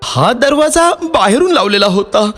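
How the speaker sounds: high-pitched man explaining; reply as the man, pitched high; man's character voice. Acted. Man answers the fox. (माणसाचा उंच आवाज)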